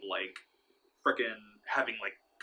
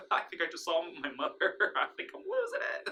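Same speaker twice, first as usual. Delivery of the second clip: high-pitched retold panic, half laughing; mock panicked wife; 7 semitones higher than usual. Wife telling her husband about seeing her mother, mock panicked. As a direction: imitate a rattled wife, pitch climbing.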